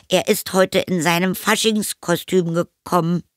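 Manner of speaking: put-on high, strained boy voice; boy fibbing. A narrator's reading. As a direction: offer a shaky cover story as a child. Boy inventing excuse for hidden friend. (hohe Jungenstimme mit Notlüge)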